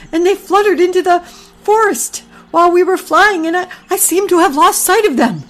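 high, fretful goose voice; a flustered mother goose, fussing; worried mother goose. Acted.

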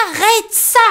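high mock yell; Tom yelling. Tom to Grumbo, high yell. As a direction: shout at his friend to stop. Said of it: "hurle Tom".

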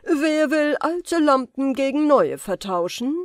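laut und höher ausgerufen wie ein Straßenhändler; Ausrufer. A narrator's reading. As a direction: wie ein Händler über den Platz rufen. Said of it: "erscholl sein Ruf". (Zauberer ruft vor dem Palast, laut wie ein Marktschreier)

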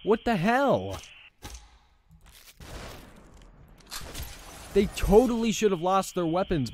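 high, loud insistent complaining; frustrated rant. Real, not acted. Player insisting enemies should be disarmed, loud frustrated rant. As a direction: argue with the game.

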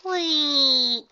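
very high, loud bubbly pony voice; Pinky, exclaiming. Acted. Pinky introduces herself to the gym leader, very high and bubbly.

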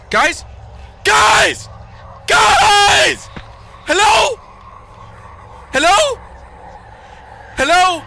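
high panicked yells for friends; screaming. Real, not acted. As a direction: scream for help, scared. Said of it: "You've just been screaming".

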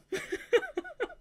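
high squealing giggle bursting out; giddy laugh. Real, not acted. Reader giggling at the book, high squeal laugh. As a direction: let a delighted squeal-laugh escape. Reader reacting to romance line.

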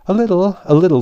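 high, loud, flustered repetition; rather nervous. A narrator's reading. The king answers the Unicorn about the fight, nervously. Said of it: "replied rather nervously".

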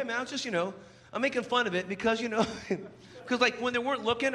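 normal talking voice

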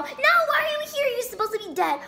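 shocked cry. Acted. A girl shocked that her rival is back. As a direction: gasp out the question in disbelief. Shocked character, much higher.

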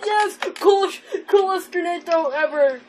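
high, loud triumphant cheer; real elation. Real, not acted. Celebrates a winning grenade throw.

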